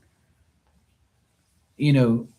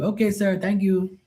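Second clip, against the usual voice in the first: put-on high, polite Filipino-accented "sir" voice; imitating Filipino pool guy; 7 semitones higher than usual. Pool cleaner greeting Mark politely, in a high Filipino-accented voice. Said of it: "And I was like".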